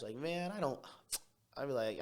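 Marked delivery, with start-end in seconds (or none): 0.1-0.8 higher-pitched mock brashness; brash. Student imitates his own brash way of talking, brash. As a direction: toss it out brashly. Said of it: "I was talking really brash".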